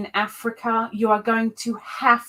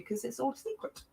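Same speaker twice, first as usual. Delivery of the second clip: usual speaking voice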